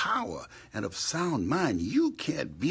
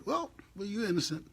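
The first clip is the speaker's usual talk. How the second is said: high sarcastic lightly voiced officials; mock verdict; 12 semitones higher, faster than usual. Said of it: "And they say".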